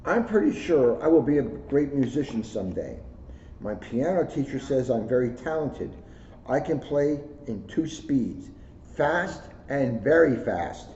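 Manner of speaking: put-on bright, lively storybook read in a child's voice; reading to children. A narrator's reading. Officer reading a picture book to schoolkids, lively storybook voice. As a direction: read it like a proud kid telling his story. Reads kids' book aloud.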